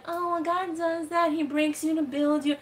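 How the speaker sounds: mock pious advice. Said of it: "people are like".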